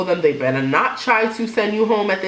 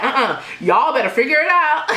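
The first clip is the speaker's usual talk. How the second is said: put-on southern accent; 7 semitones higher than usual. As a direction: give it a sassy southern twang. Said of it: "I don't have a good southern accent".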